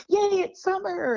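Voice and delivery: high, bright cheery squeal; mock cheerful weather. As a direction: squeal it like an excited kid. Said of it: "the weather is like".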